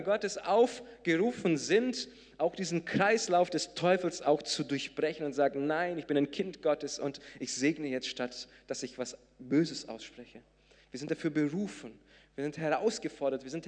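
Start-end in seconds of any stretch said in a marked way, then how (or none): none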